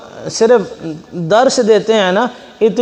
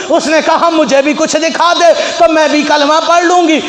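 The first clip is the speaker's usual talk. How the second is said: higher-pitched challenging wife; wife daring her husband, raised pitch; wife's voice; 5 semitones higher than usual. Acted. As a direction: taunt him as the doubting wife.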